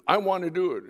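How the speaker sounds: high, loud burst of eagerness; eager volunteering. Retold or imitated. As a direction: jump in as the keen volunteer. Eager student volunteering, retold.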